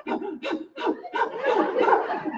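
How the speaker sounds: very high, soft yelping whine; doggy moan. Acted. Performer demonstrating the doggy moan, high whining yelps. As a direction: whimper like a puppy. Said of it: "The doggy moan".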